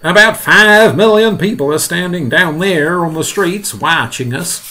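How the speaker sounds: high, loud voice easing at the end; urgent announcement. Acted. Fire chief urging action before watching crowds, loud urgency. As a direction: press the point at high volume.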